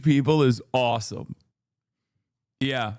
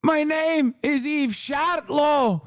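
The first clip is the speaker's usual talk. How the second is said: much higher, slow, shouted correction; shrieking Eve Barlow; 8 semitones higher, slower than usual. Acted. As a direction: scream the correction, stretching each word. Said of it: "Eve Fatlow".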